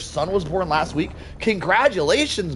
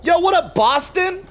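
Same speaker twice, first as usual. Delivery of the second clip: excited greeting; 12 semitones higher, slower than usual. Real, not acted. Greeting a new player loudly.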